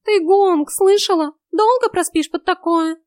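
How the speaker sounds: high, loud retort; high-pitched, loud irritation; snarky, irritated cat. A narrator's reading. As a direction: snap at her as a cat. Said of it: "окрысился Васька".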